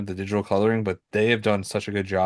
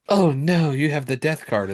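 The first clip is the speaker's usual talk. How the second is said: higher, hammed-up movie alarm; mock dread; 6 semitones higher than usual. Mocking movies that use the tarot death card, mock dread. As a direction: imitate a film character's overdone dismay.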